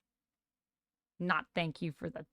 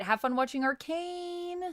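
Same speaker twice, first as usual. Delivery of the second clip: sing-song; 9 semitones higher than usual. Real, not acted. High sing-song send-off.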